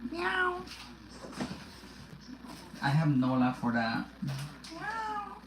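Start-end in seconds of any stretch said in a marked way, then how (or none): 0.1-0.6 very high mewing meow; high kitten meow; cat meow. Pretend. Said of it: "You wanna meow too?". 4.2-5.4 very high, soft kitten meow; squeaky little meow; cat meow. Pretend. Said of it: "You wanna meow too?".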